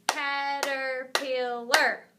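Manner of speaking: "Caterpillar" slow, in four separate beats; syllable by syllable. Shown as a demonstration. Teacher clapping a longer word with children, "Caterpillar" slowly split up. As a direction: stretch "Caterpillar" out, one syllable per clap. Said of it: "We'll clap it together".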